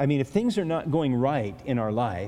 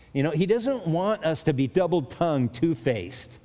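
normal talking voice

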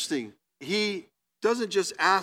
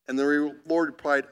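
normal talking voice

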